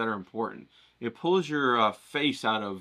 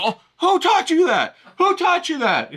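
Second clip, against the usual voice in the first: mock-mad high-pitched yell; comically scandalized shout; Yim; 10 dB louder than usual. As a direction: imitate an outraged teacher, very high and loud. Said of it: "He'd be all mad and stuff".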